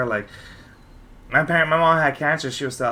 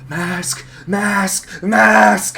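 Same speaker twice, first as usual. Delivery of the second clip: drawn-out mask rising higher each time; dramatic; 6 semitones higher, 6 dB louder, much slower than usual. Retold or imitated. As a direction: ham it up, building higher. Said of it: "Oh my God. So dramatic".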